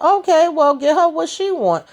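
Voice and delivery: high, pushy advice voice. Retold or imitated. Duda urging Emmett in a high voice.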